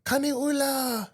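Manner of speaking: high, drawn-out mock call between houses; imitated yell across. Kids calling to the boy next door, high drawn-out mock yell. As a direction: call out long and high, without full volume. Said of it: "they're yelling across the house".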